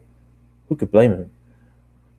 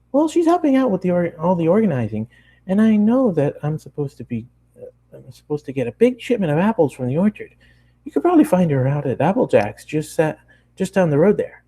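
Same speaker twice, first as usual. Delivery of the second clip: bubbly, much higher cartoon pony voice; high Pinkie voice; 8 semitones higher than usual. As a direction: chatter it up high like an excited pony. Pinkie voiced in read story.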